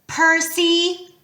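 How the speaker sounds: high, loud call of a name. A narrator's reading. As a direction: call after someone leaving. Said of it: "Annabeth called".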